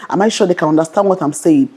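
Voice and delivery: usual speaking voice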